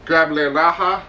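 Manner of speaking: a lightly put-on bad Spanish accent on rag; mock Spanish accent, barely changed from own voice; poor Spanish accent. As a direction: joke the word rag as Spanish, not really committing. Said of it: "me saying rag in a Spanish accent".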